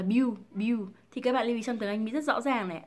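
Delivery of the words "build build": "build" as "biu", no L or D; common wrong build. Shown as a demonstration. Teacher mimicking learners, "build" as "biu". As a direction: round "build" off into a U sound. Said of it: "Các bạn hay đọc là".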